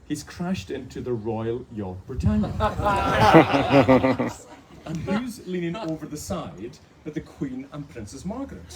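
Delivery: normal talking voice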